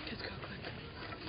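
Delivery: slow urgent whisper; hushed getaway whisper. Real, not acted. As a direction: hurry him along under your breath. Sneaking off after prank.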